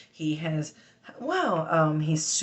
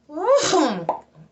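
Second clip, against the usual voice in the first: vocal tic; 11 semitones higher, 8 dB louder than usual. Real, not acted. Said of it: "I'm agitating my tics".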